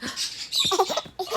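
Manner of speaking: sharp high breathy gasp of delight. Real, not acted. Surprised at seeing a baby, a high delighted gasp.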